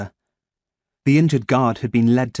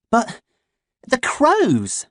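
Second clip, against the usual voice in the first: put-on much higher girl's voice, protesting; a worried little sister's objection; girl pointing at the crows, higher voice; young sister; 12 semitones higher, much slower than usual.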